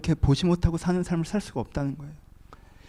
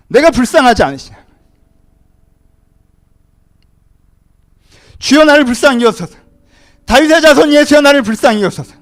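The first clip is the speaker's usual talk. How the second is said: loud, high shouted plea; acted desperate cry; 10 semitones higher, 12 dB louder than usual. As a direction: cry out for mercy as the blind beggar. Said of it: "그래서 소리를 지르는 거예요". (절박하게 부르짖는 외침)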